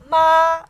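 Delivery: MA high, loud, level; first tone. Shown as a demonstration. Teacher explaining tone marks, high level MA. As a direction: hold MA on one high line. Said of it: "first tone with a straight line".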